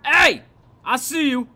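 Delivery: loud, high startled yell; shouting. Real, not acted. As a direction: yell out at the shadow. Spots enemy in the dark.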